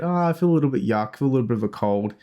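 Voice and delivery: ordinary delivery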